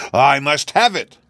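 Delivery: high, loud, insistent cry; screamed demand. A narrator's reading. King insists on his belt, in a high loud cry. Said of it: "screamed the king".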